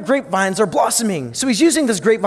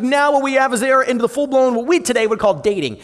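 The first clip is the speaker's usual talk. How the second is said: ordinary delivery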